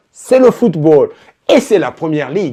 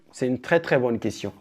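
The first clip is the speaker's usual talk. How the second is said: "très bonne question" dropped much softer, nearly under the breath; hushed repeat; 5 semitones lower, 13 dB quieter than usual. Shown as a demonstration. Repeats the phrase, trailing off. (à voix basse, en aparté)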